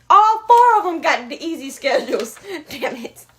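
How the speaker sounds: laughing surprise, loud; laughing in disbelief. Real, not acted. Surprised realization while laughing.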